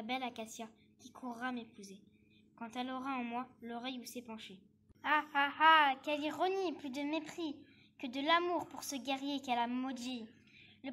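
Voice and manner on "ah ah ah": loud, deliberate villain's "ha ha ha"; three big declaimed laughs of bitter irony; mocking stage laugh. Schemer laughs at his revenge. (rire théâtral et moqueur, très sonore)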